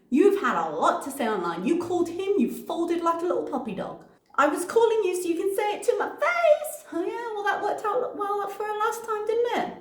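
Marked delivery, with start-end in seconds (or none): 4.3-9.7 high mocking read. Mock reading her message.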